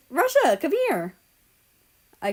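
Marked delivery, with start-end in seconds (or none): 0.1-1.1 calling out. A narrator's reading. Calling Russia from the shadows.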